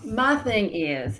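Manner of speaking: normal talking voice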